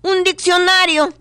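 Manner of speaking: very high pushy salesman; salesman squeak. Acted. Salesman keeps pushing wares.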